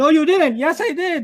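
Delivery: playful bickering climbing in pitch; escalating mock argument. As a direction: keep raising the stakes in a silly back-and-forth. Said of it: "when you have an argument".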